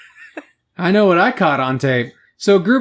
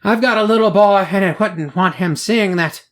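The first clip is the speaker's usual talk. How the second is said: a light, half-hearted Irish lilt; amateur Irish accent on a quoted resident; 2 semitones higher than usual. Retold or imitated. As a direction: read the local mum's quote in a mock Irish voice. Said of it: "That sounded Irish".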